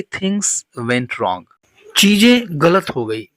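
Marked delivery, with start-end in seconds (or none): 0.0-1.4 slow model phrase. Shown as a demonstration. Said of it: "देखिए, बात बिगड़ गई".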